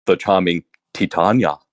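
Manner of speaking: ordinary talk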